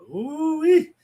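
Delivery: high-pitched ooh-wee; excited whoop. Real, not acted. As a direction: whoop it up with delight. Reacting to wild show news.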